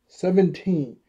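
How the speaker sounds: normal talking voice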